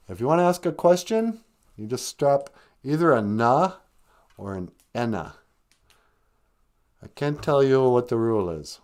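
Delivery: ordinary delivery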